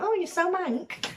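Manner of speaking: high, drawn-out imitation of others; high-pitched teasing quote; mock exclamation. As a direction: mimic them in a high, slow voice. Mimicking what locals say to her.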